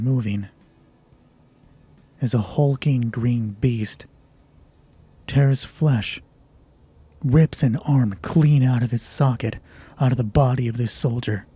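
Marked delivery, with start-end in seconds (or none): none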